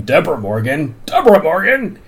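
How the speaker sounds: half-restrained imitation of screaming; mock scream. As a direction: call the name out, pitching it up the second time. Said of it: "He starts screaming".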